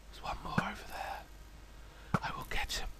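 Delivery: fast, hushed whisper. Real, not acted. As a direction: sneak up and whisper it. Sneaking toward enemy.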